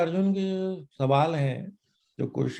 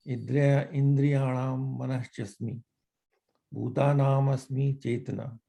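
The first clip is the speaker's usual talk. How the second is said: scripture recitation; 5 semitones lower, 4 dB quieter than usual. Acted. A reader chanting Gita verses to the study group. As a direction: recite each verse, then translate it line by line.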